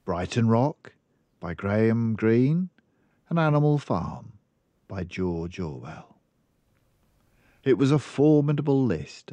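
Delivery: ordinary delivery, nothing marked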